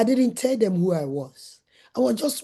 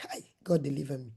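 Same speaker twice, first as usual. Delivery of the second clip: breathless whispered plea to God; whispered prayer; 7 semitones lower, 9 dB quieter than usual. Retold or imitated. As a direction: whisper it like a desperate little prayer. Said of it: "I said".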